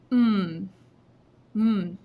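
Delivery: hummed syllable to count beats; humming the word. Shown as a demonstration. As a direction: hum the word with lips closed. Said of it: "Let's hum it just to double check".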